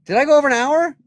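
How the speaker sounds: high, loud startled question; surprised question. Real, not acted. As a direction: blurt it out, voice jumping up. Startled at show's running time.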